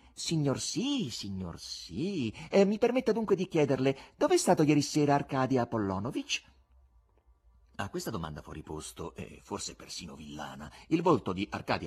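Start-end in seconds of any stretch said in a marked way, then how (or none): 0.1-6.4 swooping mock-obedient showman; insolent buffoon. Buffoon baits the patron with a question, mock-polite. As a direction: agree with exaggerated courtesy, then lift into the question.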